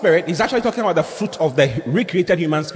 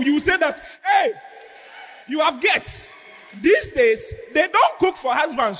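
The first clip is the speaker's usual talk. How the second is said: high-pitched mock rant, half laughing; angry scolding; 4 semitones higher than usual. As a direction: berate the husband, indignant and hammy. Acted scolding of husband.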